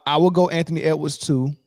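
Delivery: usual speaking voice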